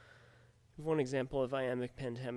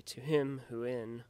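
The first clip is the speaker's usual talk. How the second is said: slow, with "him" and "in" stressed, "To" and "who" light; exaggerated iambic stress; 4 dB louder, slower than usual. Shown as a demonstration. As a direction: lean hard on "him" and "in". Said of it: "the stressed syllable is always preceded".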